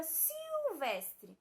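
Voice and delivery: "silvestre" with a stretched "siu" opening; L sounded as U. Shown as a demonstration. Teacher reads the list B word, "silvestre" with L sounded as a long U. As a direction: draw out "silvestre" as "siu-vestre". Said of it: "ele vai ter som de U".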